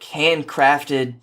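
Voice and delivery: plain reading voice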